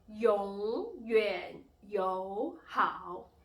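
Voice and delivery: 永远友好 very slow and loud, swooping tones; teacher's exaggerated tone drill on 永远友好; third-tone demo. Shown as a demonstration. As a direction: stretch each syllable of 永远友好 through its dip and rise. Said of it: "symbol of the third tone".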